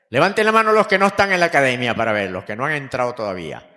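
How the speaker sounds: loud, fast call to the room; rally the hall to raise hands. Real, not acted. Pide manos a la congregación. (llamado en voz alta y rápida)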